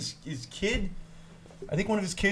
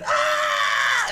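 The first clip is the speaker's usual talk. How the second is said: retold scream; 5 semitones lower, 5 dB louder than usual. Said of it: "she's screaming".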